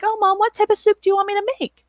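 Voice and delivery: put-on a helpful kid offering to cook, bright and quick; eager son. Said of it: "And my son's like".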